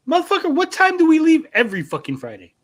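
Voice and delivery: mock furious yell. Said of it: "I said".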